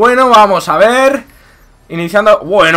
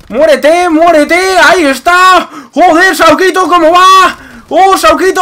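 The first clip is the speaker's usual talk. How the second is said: excited gamer yell; 9 semitones higher, 5 dB louder, slower than usual. Real, not acted. Frantic kill shouting.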